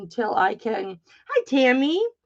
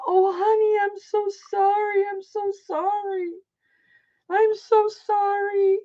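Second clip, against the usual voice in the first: apologetic mom; 11 semitones higher than usual. Retold or imitated. Mom voiced apologizing in story.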